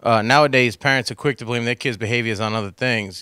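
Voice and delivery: ordinary talk, nothing marked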